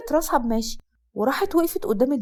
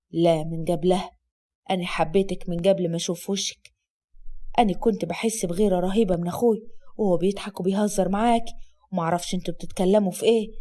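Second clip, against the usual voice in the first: soothing; 6 semitones lower than usual. Acted. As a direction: hold her and calm her. Asad confessing low.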